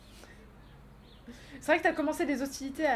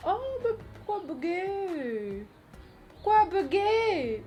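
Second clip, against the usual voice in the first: high, very slow whiny complaint; mock whine; 6 semitones higher, much slower than usual. Real, not acted. Streamer moans at chat that the alert visual bugged, slow whine.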